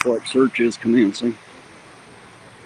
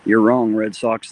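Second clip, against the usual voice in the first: loud; 3 semitones higher, 8 dB louder, slower than usual. Real, not acted. Arguing back at a chat viewer.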